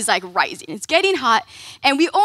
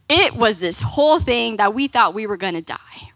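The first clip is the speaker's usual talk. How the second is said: usual speaking voice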